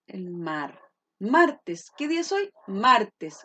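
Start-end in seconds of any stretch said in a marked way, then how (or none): 1.2-1.9 MAR in Martes punched loud; stress on first syllable. Shown as a demonstration. Teacher showing the tonic syllable, loud MAR in Martes. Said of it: "¿Y dónde se carga la voz?". 2.7-3.4 MAR in Martes hit very loud; exaggerated stress. Shown as a demonstration. Teacher answering what day it is, overdone MAR in Martes. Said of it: "uno no anda por la vida".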